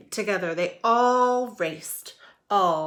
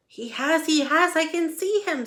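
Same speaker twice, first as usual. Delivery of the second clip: excited; 9 semitones higher, 5 dB louder than usual. Acted. Excited elephant spots Wilbur.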